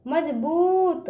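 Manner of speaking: slow मजबूत, बू held long; drawn-out long ū. Shown as a demonstration. Teacher showing "this is the way", मजबूत with long ū. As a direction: stretch the ū in मजबूत. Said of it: "इस तरह से".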